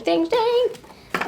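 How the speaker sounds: high, loud sing-song repetition; playful squeal. Real, not acted. Reader delighting in the card, high playful squeal.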